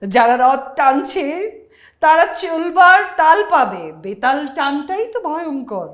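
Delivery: slow, loud, high theatrical declaiming; jatra dialogue. Acted. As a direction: boom it out like a stage hero. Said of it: "যাত্রার একটা script দেখলাম".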